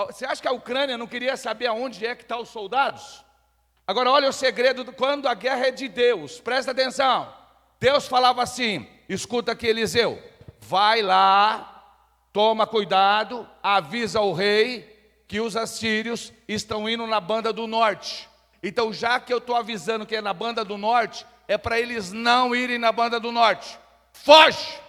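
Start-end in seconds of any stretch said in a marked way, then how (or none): 3.9-23.8 loud, high preaching build; fiery preaching. Acted. Preaching at full force. 24.1-24.8 sudden loud shout; preacher shouting the warning from God. Acted. God's warning acted out.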